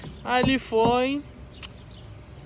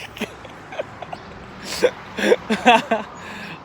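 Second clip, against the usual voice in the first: long breathy laugh; 3 semitones higher than usual. Real, not acted. Laughing at his own story.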